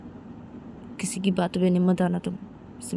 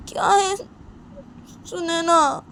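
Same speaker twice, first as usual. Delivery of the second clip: put-on pitched way up, pleading girl; high, anxious young girl insisting; girl voice pushed high, defensive; Simran's high voice; 12 semitones higher, 5 dB louder, slower than usual.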